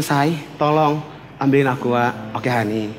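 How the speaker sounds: soft, slow sweet asking; polite request. Shown as a demonstration. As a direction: ask sweetly and quietly. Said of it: "Kan lebih enak gini". (istri meminta dengan lembut dan pelan)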